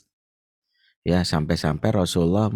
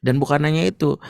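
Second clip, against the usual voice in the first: normal talking voice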